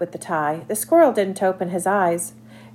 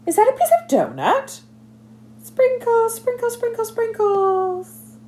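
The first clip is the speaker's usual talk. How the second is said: high, excited little squirrel voice. Acted. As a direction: chirp it out like a giddy squirrel. Squirrel's poem voice.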